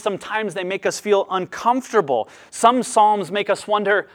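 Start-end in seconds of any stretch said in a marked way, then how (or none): none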